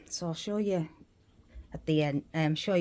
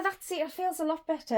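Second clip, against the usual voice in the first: ordinary delivery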